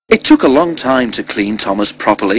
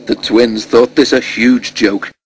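plain reading voice

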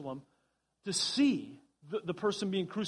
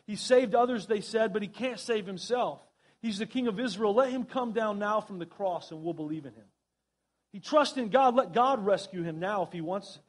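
ordinary talk, nothing marked

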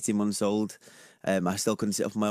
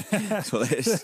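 laughing; 10 semitones higher, slower than usual. Real, not acted. Laughing after a joke.

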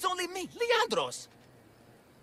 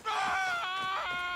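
taunting roar; 5 semitones higher, 3 dB quieter than usual. Acted. Minotaur taunting the guards.

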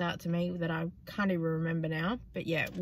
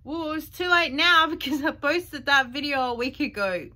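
high, louder sassy reply; cheeky comeback; 7 semitones higher, 6 dB louder than usual. Retold or imitated. Replying to the scolding commenter, high sassy retort. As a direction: fire it back with cheek. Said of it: "I went".

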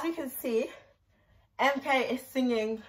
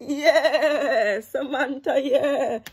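loud, raised, wobbling cheer with laughter; whooping cheer; 4 semitones higher, 7 dB louder, much slower than usual. Real, not acted. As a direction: cheer them on, stretching each word.

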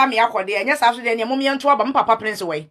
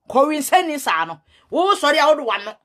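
normal talking voice

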